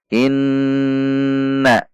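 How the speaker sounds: "Inna" with the nn hummed long and high; "Inna" with a long held nn; held nasal ghunnah. Shown as a demonstration. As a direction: hold the nasal hum of "Inna" two beats. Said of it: "yang panjang adalah pada tasydidnya".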